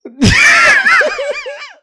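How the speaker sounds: shrieking laughter; high laughing burst. Real, not acted. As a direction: explode into laughter.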